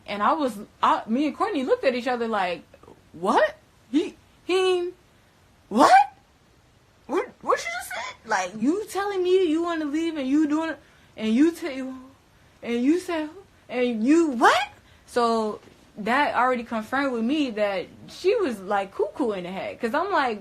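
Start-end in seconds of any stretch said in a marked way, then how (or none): 3.1-14.7 high, loud, incredulous what; disbelieving outburst at her answer; stunned disbelief. Retold or imitated. Shocked outburst at Geneva's answer.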